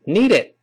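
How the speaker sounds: "need" run straight into "it" as "nee-dit"; one joined word from "need", t held back; quick linked "need"; linked reading. Shown as a demonstration. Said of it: "连读一遍".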